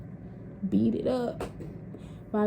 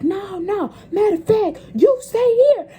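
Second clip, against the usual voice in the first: much higher and louder, bossy auntie; commanding auntie; 11 semitones higher, 7 dB louder than usual. Retold or imitated. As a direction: order her to stay put. Auntie changes her mind.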